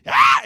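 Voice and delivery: loud high panic scream; comic scream. Retold or imitated. Joseph screams loudly as he flees the wife. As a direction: scream and run.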